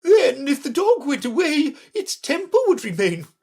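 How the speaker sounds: very high, crowing queen; triumphant. A narrator's reading. Red Queen winning her point over Alice, triumphant. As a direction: clinch the argument in a high, emphatic voice. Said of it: "the Queen exclaimed triumphantly".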